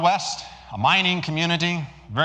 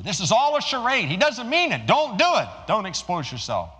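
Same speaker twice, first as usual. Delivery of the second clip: urgent warning; 8 semitones higher, 4 dB louder than usual. Acted. Crowd warns the couple.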